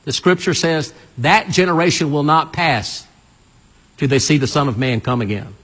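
ordinary talk

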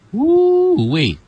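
falsetto woo-wee whoop; 1 high whoop. Real, not acted. Pastor whooping during deliverance, high falsetto whoop.

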